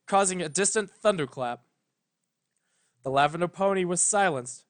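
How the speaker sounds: plain reading voice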